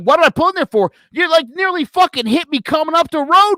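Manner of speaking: indignant. Retold or imitated. Driver to cop who nearly hit him, indignant. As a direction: throw the question back in outrage.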